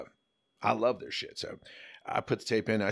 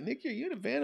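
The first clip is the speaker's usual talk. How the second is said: impressed; 3 dB quieter, faster than usual. Retold or imitated. Jerry asks, impressed.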